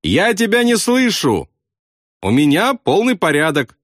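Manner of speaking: high-pitched narrated shout at no great volume. A narrator's reading. Bombardier insists he cannot hear, high shout. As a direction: call out high, stretching the words. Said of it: "он крикнул".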